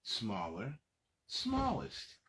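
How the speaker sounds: put-on lively read-aloud for little kids; reading to children. Adult reading a picture book to young children, lively storytelling. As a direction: read it out bright and animated for the kids.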